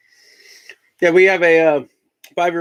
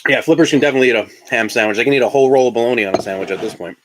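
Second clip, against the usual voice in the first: ordinary talk, nothing marked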